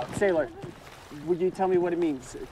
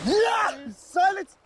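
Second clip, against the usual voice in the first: sudden outburst; 14 semitones higher, 3 dB louder, much slower than usual. Acted. Castaway screaming against the silence, high and loud. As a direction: burst out, unable to stand the silence.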